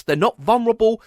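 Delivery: ordinary delivery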